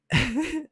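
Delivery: sudden high burst of laughter; real laugh. Real, not acted. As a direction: crack up at her reply. Laughs at her okay.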